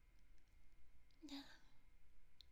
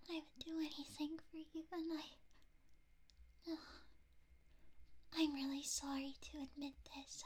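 ordinary talk

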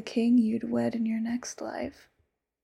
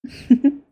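short delighted laugh; gleeful little laugh; giddy laugh; 4 semitones higher, 12 dB louder than usual. Acted. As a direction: laugh with possessive glee. Giddy about marriage.

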